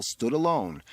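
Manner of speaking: usual speaking voice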